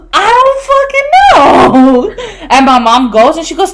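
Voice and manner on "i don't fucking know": high, loud, slow, desperate cry; panicked yell. Retold or imitated. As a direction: wail it out in panic. Panicked friend, flooded bathroom.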